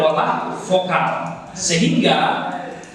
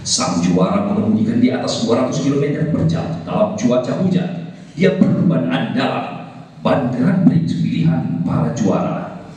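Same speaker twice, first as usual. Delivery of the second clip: low, driving tyre-commercial announcer; low announcer voice selling to champions; imitated TV advert; 6 semitones lower than usual. Trainer imitates old TV tyre ad, low announcer voice. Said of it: "suara-suara iklan".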